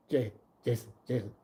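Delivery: low, hushed mocking aside; lightly voiced sneaky uncle; sly whisper. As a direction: murmur it behind her back. [name] whispering to Jason.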